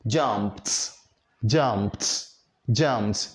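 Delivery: "jumped" with a released "t" at the end; voiceless T ending. Shown as a demonstration. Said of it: "you have to pronounce it as".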